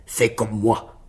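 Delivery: lightly voiced giant, a bit low; géant. A narrator's reading. Le géant met au défi. (géant légèrement esquissé, défi grave)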